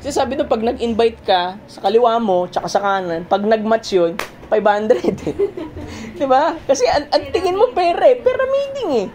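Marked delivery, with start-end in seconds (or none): 5.8-9.1 laughing. Real, not acted. Laughing at money talk.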